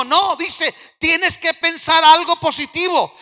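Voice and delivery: high, loud, driving sermon delivery; forceful preaching. Real, not acted. (predicación enérgica, voz alta y aguda)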